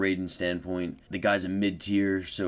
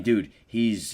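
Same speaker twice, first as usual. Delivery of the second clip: ordinary talk, nothing marked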